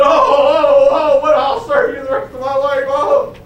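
high, loud, desperate wailing plea; groveling servant wail. Pretend. The servant the preacher says should have begged, groveling and wailing. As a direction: beg for your life, hands clasped. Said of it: "it should say".

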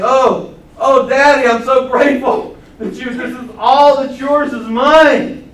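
loud, high grateful outcry; crying out. Retold or imitated. Believer cries out to God, loud and grateful. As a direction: pour out thanks to Daddy. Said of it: "crying out of saying".

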